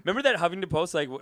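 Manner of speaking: ordinary talk, nothing marked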